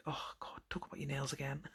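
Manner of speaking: soft, whispery imitation of a weary mum; imitating a fed-up mum, hushed and sighing; exasperated sigh. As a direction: sigh it out under your breath, mock-exasperated. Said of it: "she does, like, sighs".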